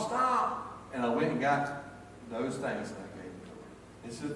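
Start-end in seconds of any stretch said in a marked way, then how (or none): 0.0-0.7 high, sharp holler across the yard; hollered stop. Retold or imitated. Grandpa calling to granddaughter to halt, hollering. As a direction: call out to halt her. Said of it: "and so I hollered".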